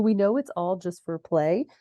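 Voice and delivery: usual speaking voice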